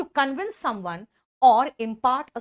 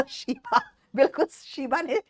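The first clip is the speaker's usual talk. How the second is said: laughing; 3 semitones higher, slower than usual. Real, not acted. Teacher laughs at student comment.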